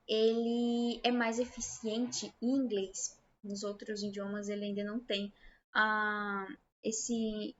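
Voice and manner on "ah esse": ordinary talk, nothing marked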